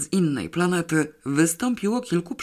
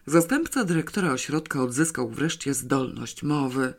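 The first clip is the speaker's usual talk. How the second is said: usual speaking voice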